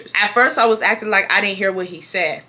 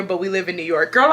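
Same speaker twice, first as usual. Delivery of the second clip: usual speaking voice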